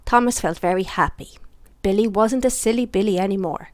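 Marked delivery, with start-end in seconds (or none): none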